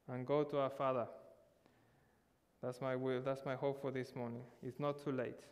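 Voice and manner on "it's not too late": normal talking voice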